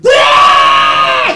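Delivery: high, loud child's scream, imitated; imitated real cry. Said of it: "and then a".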